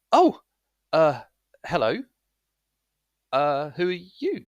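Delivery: high, hesitant surprise; shocked young man. Acted. Young man startled by strangers at his door, shocked. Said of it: "looks really shocked".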